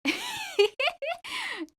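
a short high giggle at his joke; light laugh. Acted. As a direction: laugh softly, amused. Girl laughs at uncle's story. (মৃদু হাসি)

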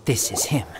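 hushed whisper over the body. Real, not acted. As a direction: keep it secret, barely voiced. Hushed at a crime scene.

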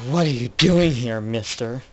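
loud, accusing demand; mock interrogation. Detective grilling a toy suspect, loud and accusing. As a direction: lean in and demand an answer.

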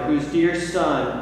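normal talking voice

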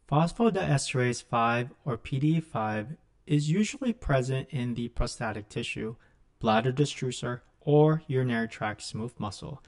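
normal talking voice

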